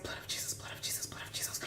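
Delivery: rapid repeated whisper; whispered prayer. Retold or imitated. She prays while walking away from the man, urgent whisper. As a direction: whisper it over and over under the breath.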